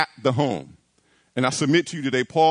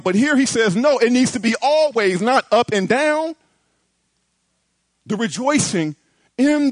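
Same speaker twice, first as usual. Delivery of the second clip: higher and louder, driving the point home; preaching at full force; 6 semitones higher, 3 dB louder than usual. Acted. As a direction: build the sermon to a ringing peak. Preaching climax, rising.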